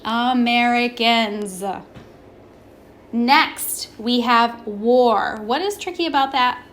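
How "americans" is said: AMERICANS slowly, ending on a clear buzzed Z. Shown as a demonstration. Teacher shows the final S in Americans sounds like Z, slowly sounded out. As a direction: stretch out "Americans" to a final zzz. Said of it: "sounds like a Z".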